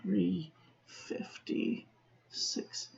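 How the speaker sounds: unvoiced whisper, slow and drawn out; a slow whispered aside. Real, not acted. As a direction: breathe the time out under your breath. Reading time, whispered aside.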